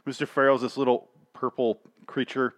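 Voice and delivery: ordinary talk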